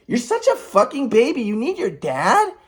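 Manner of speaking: mock a grown man as a baby; mocking put-down. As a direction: imitate a scornful aunt. Retold aunt belittling him.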